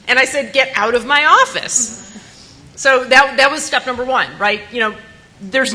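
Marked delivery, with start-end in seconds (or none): 0.5-2.0 retold order.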